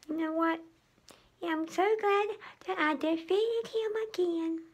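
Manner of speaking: high, slow put-on voice, dreamy and relaxed; cartoonish character voice. Hero tidying up after defeating the melting villain, high and drowsy. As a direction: play a sleepy little cartoon hero after his shower.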